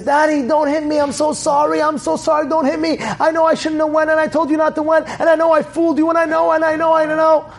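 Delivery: high, breathless run-on apology; acted frightened boy's high-pitched pleading; pleading child. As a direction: beg in a high voice without a pause. Son begs father not to hit.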